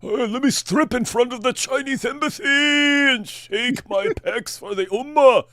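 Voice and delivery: high, slow hammed-up meathead voice; mock show-off brute. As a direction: play a dumb brute bragging about his pecs. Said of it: "That's who they listened to".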